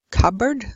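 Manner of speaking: "cupboard" with the P left silent. Shown as a demonstration. Teacher showing learners a problem word, "cupboard" with silent P. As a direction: say "cupboard" as cub-erd, no P sound. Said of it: "You don't pronounce the P".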